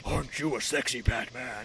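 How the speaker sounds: very low, soft hero voice; low vigilante murmur to himself; Batman. Acted. Hero admires himself in mirror, low soft voice. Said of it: "in front of the mirror and he's like".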